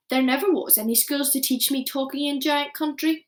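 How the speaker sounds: ordinary delivery, nothing marked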